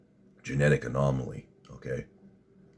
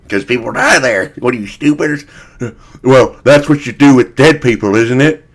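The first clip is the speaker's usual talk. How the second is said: loud, high sneering retort; mock scorn; 8 semitones higher, 14 dB louder than usual. Streamer answering the video interviewer, mock scorn. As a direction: scold the questioner like they're stupid.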